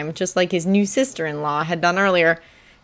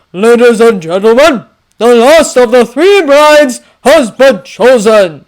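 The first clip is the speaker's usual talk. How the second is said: loud, raised, grand proclamation; king's public announcement; 4 semitones higher, 12 dB louder, slower than usual. Acted. King announcing the chosen bride to guests, loud proclamation. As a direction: proclaim it across a great hall.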